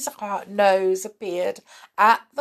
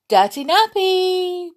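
high, loud, drawn-out silly voice; funny voice; 13 semitones higher, 7 dB louder than usual. Shown as a demonstration. Talking silly to a baby, funny voice. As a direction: coo it to make a baby laugh. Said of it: "if you say it in a funny voice".

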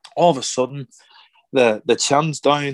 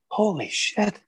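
high, breathy-quiet astonished "Holy shit"; hushed awe; 7 semitones higher, 6 dB quieter, slower than usual. Retold or imitated. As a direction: gasp it out in disbelief under your breath. Awed watching De Niro transform.

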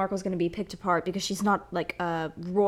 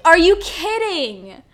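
slow, loud incredulous repeat; drawn-out outrage; 7 semitones higher, 7 dB louder, slower than usual. Real, not acted. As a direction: stretch it out in disbelief. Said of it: "repeated, slower".

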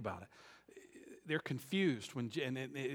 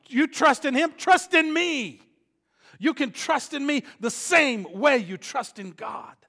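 loud, higher preaching voice; preaching at full force; 8 semitones higher, 14 dB louder than usual. Acted. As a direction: preach it hard to the congregation.

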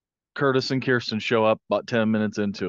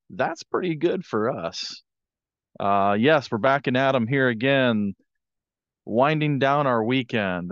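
normal talking voice

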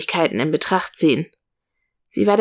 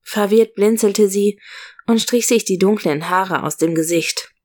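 ordinary delivery, nothing marked

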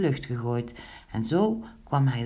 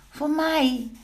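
high, loud cat voice; eager cat calling out; 11 semitones higher, 5 dB louder than usual. A narrator's reading. Snorrebaard claims the next pancake, high eager call. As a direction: pipe up as the hungry cat. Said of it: "riep Snorrebaard".

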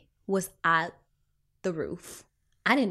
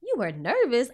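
surprised colleague; 4 semitones higher than usual. Retold or imitated. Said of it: "She was like".

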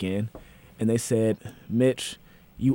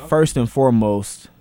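slow, loud formal start; solemn formal intro; solemn opening; 7 dB louder, slower than usual. Real, not acted. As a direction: open with weight.

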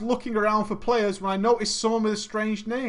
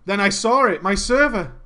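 ordinary talk

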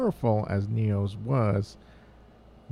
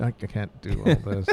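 ordinary talk

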